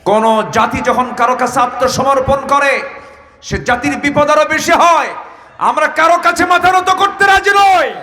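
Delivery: high, loud preaching rising into a shout; rousing defiance. Real, not acted. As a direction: fire up the crowd, climbing to full voice. Preacher rallies crowd against submission.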